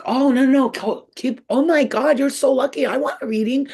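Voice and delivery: high, loud imitation of an eager customer; excited gushing. Customer learns a psychic reading is underway, imitated excited gushing. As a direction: gush as if thrilled and envious.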